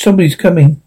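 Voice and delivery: usual speaking voice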